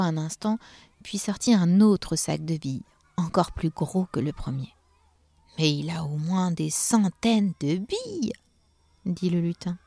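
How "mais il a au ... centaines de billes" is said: slow disbelief, swooping up at the end; astonished elf. Acted. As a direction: gape at the sheer amount. Elf amazed at the marbles.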